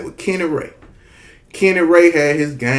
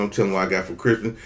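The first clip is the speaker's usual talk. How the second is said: ordinary talk, nothing marked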